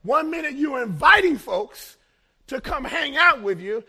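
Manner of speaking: loud, rising preacher's call to the congregation; full-force preaching that climbs on each example. Acted. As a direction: hammer each example home. Preaching resumes.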